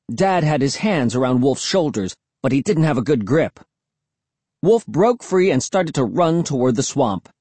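plain reading voice

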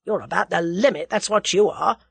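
mild growl of admiration; grudging admiration. A narrator's reading. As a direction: admit unwillingly that they have beaten you. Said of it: "growled Whittington with a sort of unwilling admiration".

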